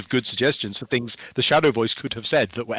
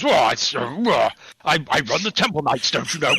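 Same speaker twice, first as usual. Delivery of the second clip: booming, higher upper-class English officer; General Melchett impression; 3 semitones higher than usual. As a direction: bluster like a pompous old British general. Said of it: "he talks like General Melchett from, uh, Blackadder Goes Forth".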